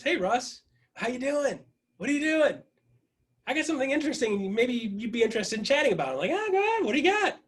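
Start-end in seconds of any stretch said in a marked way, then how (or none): 0.0-6.1 high, singsong voice of a caller; lilting high-pitched impression of her; caller's chirpy pitch. Voicing Wendy's phone call in story. 6.3-7.4 very high-pitched, playful comeback; mock-eager high voice; playful acted reply. Acting own reply to the caller.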